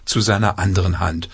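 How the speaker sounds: plain reading voice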